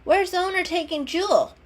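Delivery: high, loud anxious question; worried. Acted.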